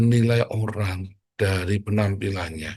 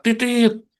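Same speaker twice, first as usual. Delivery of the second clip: "Titit" yelped very high and loud; sudden high loud shout of "Titit"; shouted word; 13 semitones higher, 7 dB louder than usual. Shown as a demonstration. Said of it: "Ketika saya berkata".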